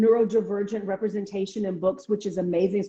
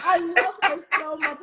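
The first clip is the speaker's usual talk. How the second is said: higher-pitched imitation of a smitten editor; gushing; 7 semitones higher than usual. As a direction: gush like an adoring fan. Editor gushing over a character, retold.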